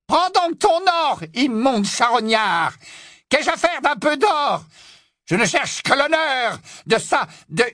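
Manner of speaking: very high, disgusted tirade; scornful outburst. Acted. Witch scorns a scavenger wanting gold, scornful. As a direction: spit contempt in a shrill witch voice. Bellatrix scorns the werewolf's greed.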